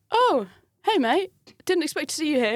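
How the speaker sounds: acted-out startled squeak; flustered surprise. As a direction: cover being caught out with a too-bright hello. Caught out, greeting him awkwardly.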